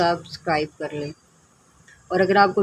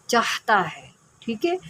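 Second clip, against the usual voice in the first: ordinary talk, nothing marked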